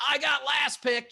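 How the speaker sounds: loud, high, drawn-out mock cheer; imitating a thrilled little brother shouting proudly; excited kid. Brother happily getting last choice, excited shout. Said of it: "just as excited as we were".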